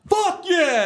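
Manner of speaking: very high, loud celebratory yell; retold stadium cheer, high and loud; touchdown cheer. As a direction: whoop at a touchdown.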